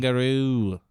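hammed-up Australian accent on one word; bad Dundee impression. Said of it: "your terrible Dundee impression".

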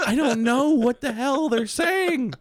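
high, drawn-out mock despair; exasperated wail. Caller baffled by a Spanish phone menu, mock exasperated. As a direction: wail it slowly in hammed-up confusion.

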